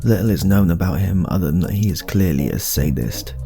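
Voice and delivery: usual speaking voice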